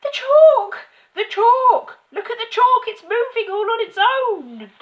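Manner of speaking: very high, very loud shriek; frantic, squealing alarm; excited screaming. A narrator's reading. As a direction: scream it like a hysterical schoolboy. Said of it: "pointing excitedly at the blackboard and screaming".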